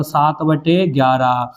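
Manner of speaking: very slow, each number spaced out; slow dictation. Acted. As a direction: spell out the fraction slowly for the class. Dictating an example to pupils.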